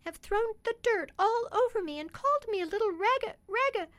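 put-on high, loud tearful child; sobbing. A narrator's reading. Dolly crying to her father about the boys, sobbing high and loud. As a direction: sob it out as a muddied little girl. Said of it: "she sobbed".

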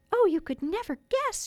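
high, excited little fairy voice; bursting with news in a tiny high voice; excited fairy cry. A narrator's reading. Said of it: "she cried".

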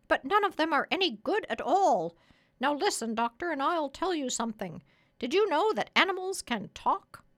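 high, loud parrot voice; bossy parrot. Acted. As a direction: urge the doctor on in a high, insistent parrot voice.

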